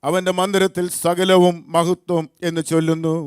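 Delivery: high, loud psalm reading; scripture recitation. Acted. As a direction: proclaim the psalm to lead a congregation. Leads responsive psalm reading.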